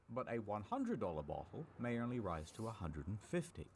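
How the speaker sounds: ordinary delivery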